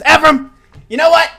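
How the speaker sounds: put-on high, loud grumpy old gnome scolding; a crotchety gnome hollering at the adventurers for showing up late; cranky old gnome yelling. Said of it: "looks past you and goes".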